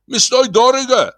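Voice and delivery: very high shouted objection; indignant squawk of protest; heated retort. A narrator's reading. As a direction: snap back at the manager, high-pitched. Tenant argues back at manager.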